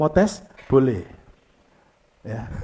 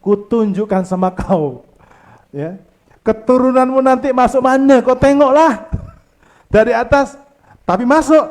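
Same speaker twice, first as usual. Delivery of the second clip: God speaking; 6 semitones higher, 5 dB louder than usual. Retold or imitated. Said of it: "Lalu Tuhan berkata ini".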